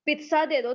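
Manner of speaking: "पिज्जा" said as pit-sa in an order; accurate pronunciation. Acted. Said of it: "पिज्जा वाले को जाके बोलेंगे". (पिज्जा को "पित्सा" बोलकर)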